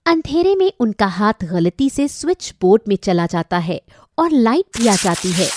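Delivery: ordinary talk, nothing marked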